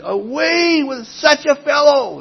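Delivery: mock crowd shout. Preacher voicing the crowd crying out against Paul, mock shout. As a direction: call it out like the hostile Jerusalem crowd. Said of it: "raised their voices and said".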